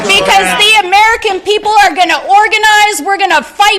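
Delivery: rising rally cry, building to a shout; rally speech. Real, not acted. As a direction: fire up the crowd.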